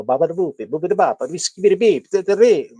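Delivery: loud, high cartoon Italian gibberish; Family Guy mock Italian. Joker quoting Family Guy, mock Italian accent. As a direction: bounce through hammed-up Italian nonsense sounds. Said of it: "Family Guy, you know".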